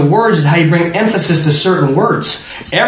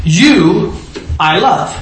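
"You" set first and punched, then a pause; stress on YOU; much slower than usual. Shown as a demonstration. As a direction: lean on "You", then let "I love" follow. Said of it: "Same words, different order".

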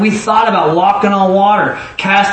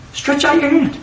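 much higher, strained-up pitch; high-pitched repeat; 9 semitones higher than usual. Shown as a demonstration. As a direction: lift the voice right up on the command. Repeats it with effort.

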